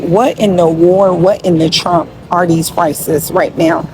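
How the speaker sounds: normal talking voice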